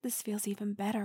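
ordinary talk

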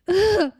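high, pained groan of frustration; anguished groan. Acted. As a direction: let the pain out in a groan. Grieving partner confronts the dead.

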